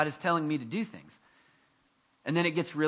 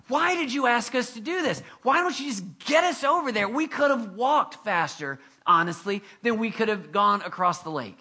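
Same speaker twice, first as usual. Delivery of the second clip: higher-pitched, exasperated protest; mock complaining; 6 semitones higher, 3 dB louder than usual. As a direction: play a disciple griping at the leader. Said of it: "The complaining of when you have to".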